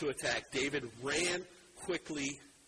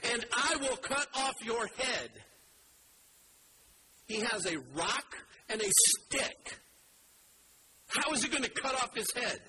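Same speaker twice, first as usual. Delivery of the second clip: lightly raised defiant cry, slowing at the end; David shouting defiance at Goliath, mildly raised; proclaimed shout; 6 semitones higher than usual. Acted. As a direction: proclaim each threat high and bold. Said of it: "He is shouting it".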